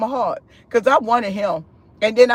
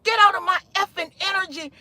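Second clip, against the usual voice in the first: high-pitched, quick mock telling-off; mild, censored cussing fit; retold angry cursing; 7 semitones higher than usual. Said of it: "I got mad and I start cursing".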